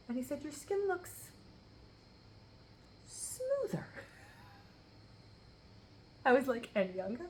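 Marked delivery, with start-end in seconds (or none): 0.4-3.8 husband's compliment. Retold or imitated. Quoting husband, very high. 3.8-7.2 laughing. Real, not acted. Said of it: "almost fell off the couch laughing".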